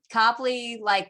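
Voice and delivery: usual speaking voice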